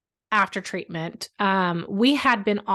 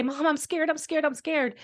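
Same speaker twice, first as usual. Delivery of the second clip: put-on panicked child; 7 semitones higher than usual. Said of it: "panic, freeze, and say".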